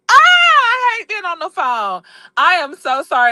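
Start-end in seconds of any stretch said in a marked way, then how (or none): 0.1-2.0 high, drawn-out whine; frustrated wail. Real, not acted. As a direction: wail it in exasperation, falling off. Complains about going live on phone.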